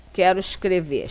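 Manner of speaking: ordinary delivery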